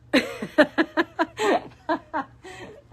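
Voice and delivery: long laugh climbing high; giggling at her own joke; high rising laugh. Real, not acted.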